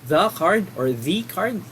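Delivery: "The card" first as thuh, then as thee, said slowly; thuh versus thee. Shown as a demonstration. Teacher contrasts two pronunciations of the, slowly. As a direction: contrast the two ways of saying "The", unhurried. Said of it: "Kailan natin sasabing the or the?".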